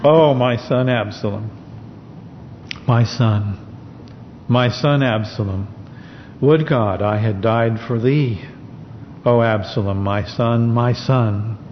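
low, grieving, a touch of weeping; weeping lament. Acted. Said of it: "and wept as he went".

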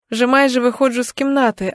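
usual speaking voice

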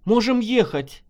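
urgent woman's exclamation; exclaiming. A narrator's reading. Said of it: "воскликнула Белова".

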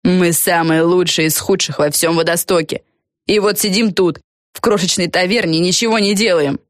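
indignant speech with some heat; mildly fervent. Acted. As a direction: complain earnestly to rouse the others. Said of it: "С жаром начала Фелисити". (с некоторым жаром, возмущённо)

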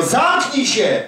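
high, slow command, Zamknij się; retold command. Wigglesworth silencing a man praying, high slow command. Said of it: "On mówił".

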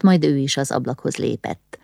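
plain reading voice

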